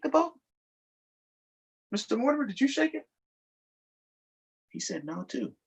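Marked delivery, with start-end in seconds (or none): none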